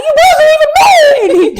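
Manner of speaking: very high, loud mock scream; yelled comeback. Prank caller yells at the man before he hangs up, high screech. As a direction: shriek it down the phone before he hangs up.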